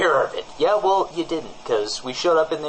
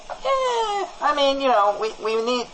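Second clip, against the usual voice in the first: squirming hedge. Acted. Squirming evasive hedging.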